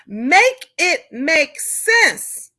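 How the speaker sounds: drawn out, word by word; very slow exasperated demand; slow emphasis. Real, not acted. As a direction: spell it out slowly in disbelief. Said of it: "slowed emphatic repeat".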